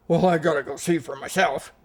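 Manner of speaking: acted-out astonished determination; insistent exclamation. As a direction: imitate someone who must see it. Acts out sceptic insisting on looking.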